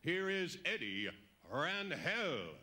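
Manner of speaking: ordinary talk, nothing marked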